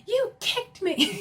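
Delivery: high, slow, loud accusation; mock outrage. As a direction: drag it out like an exaggerated wounded complaint. Acting Sutton's accusation in quarrel.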